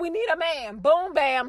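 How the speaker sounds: ordinary talk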